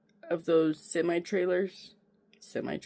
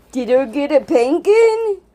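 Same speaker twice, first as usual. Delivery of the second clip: put-on talking to a baby; 6 semitones higher, 8 dB louder than usual. Baby voice for the dog.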